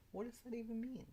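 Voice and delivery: high, incredulous question; baffled, pushing the question up high; what does that even mean, squeaked in disbelief; exasperated disbelief. Real, not acted. Incredulous repeat, much higher.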